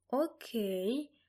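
measured, slightly hushed reply; slow and deliberate. A narrator's reading. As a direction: draw it out, taking your time. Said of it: "повагом мовила я". (повагом, неквапливо)

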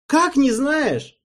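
high, loud, slow indignant cry; angry disbelief. A narrator's reading. Alvin, disbelieving and angry, demands of Erald. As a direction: drag out the outrage.

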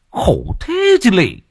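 mocking sigh. A narrator's reading. Mocking the crying friend.

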